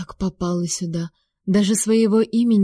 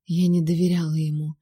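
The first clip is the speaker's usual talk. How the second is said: usual speaking voice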